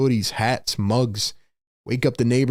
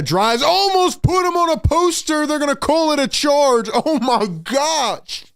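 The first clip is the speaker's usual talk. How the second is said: excited play-by-play shout; 12 semitones higher than usual. Real, not acted. Streamer calling an almost-poster dunk, excited shout. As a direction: explode into a high, stunned call. Live call of a near dunk.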